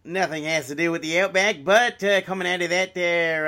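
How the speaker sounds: a hammed-up Southern accent; Larry the Cable Guy. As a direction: mimic a good-ol'-boy comedian. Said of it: "sounding like Larry the Cable Guy".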